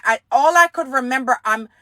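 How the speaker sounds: normal talking voice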